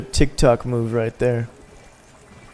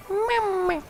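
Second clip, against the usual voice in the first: tiny falsetto, much higher and softer; squeaky high mama; 5 dB quieter than usual. Pretend. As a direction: squeak it out like a baby voice. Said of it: "you gotta say it like that".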